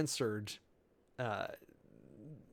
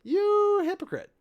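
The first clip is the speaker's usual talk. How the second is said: loud, high and slow: You hypocrite; high, drawn-out rebuke; accusing cry; 8 dB louder, slower than usual. Acted. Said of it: "the Lord then answered him and said".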